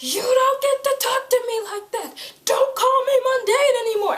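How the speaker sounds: high and loud, a cornered guy finally snapping; hammed-up threat breaking into a laugh; mock furious yell. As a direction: shout it with a blade at his neck. Voicing Simon threatening with knife.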